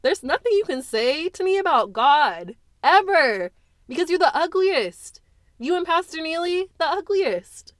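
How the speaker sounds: loud, high, venomous condemnation; furious scorn. Acted. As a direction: spit out the ugliest. Daughter denounces mother.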